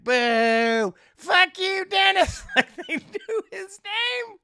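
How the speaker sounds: high, loud booing yell; hammed-up angry shout; jeering crowd. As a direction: heckle like a hostile crowd. Crowd turns and boos.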